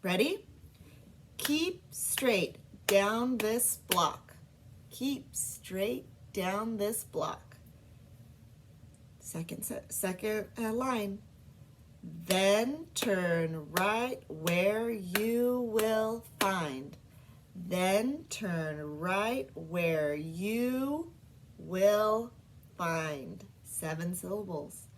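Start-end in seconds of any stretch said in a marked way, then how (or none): 1.4-7.6 slow, each syllable of "Keep straight down this block" set apart; syllables clapped. Shown as a demonstration. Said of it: "I'm gonna clap so that you can hear the different syllables". 12.2-23.5 very slow, "Then turn right where you will find" one syllable at a time; syllables clapped. Shown as a demonstration. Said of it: "I'm gonna clap so that you can hear the different syllables".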